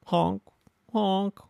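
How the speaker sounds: high cute horn honk; cute car horn imitation; little honk. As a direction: toot like a small car horn. Girl car honks little horn.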